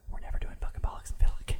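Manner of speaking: fast hushed whisper; breathy rushed whisper of refusal. Retold or imitated. Quoting himself refusing the gig.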